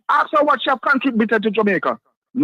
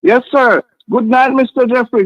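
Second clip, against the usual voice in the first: louder, for a bad phone line; speaking up; 7 dB louder than usual. Real, not acted. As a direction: raise the voice so he hears. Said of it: "I can hardly hear you, sir. Can you speak up, please?".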